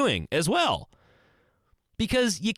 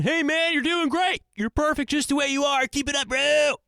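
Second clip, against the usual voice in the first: mock hype bro; 11 semitones higher than usual. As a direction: pump him up like a fake-cheerful buddy. Bros giving empty flattering praise.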